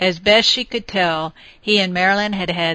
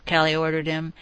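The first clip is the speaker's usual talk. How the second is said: ordinary delivery, nothing marked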